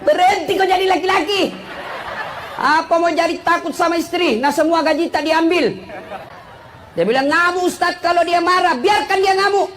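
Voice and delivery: high-pitched preaching at full force; fiery sermon. Acted. Preacher rousing husbands, fired up and high. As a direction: rouse the men in the mosque.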